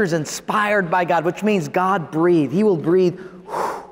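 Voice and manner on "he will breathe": ordinary talk, nothing marked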